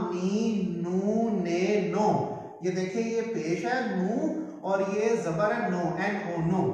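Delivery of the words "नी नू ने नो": नी नू ने नो spaced and clear, slowly; slow syllable drill. Shown as a demonstration. Teacher models the n-row syllables to students. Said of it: "वो है ना".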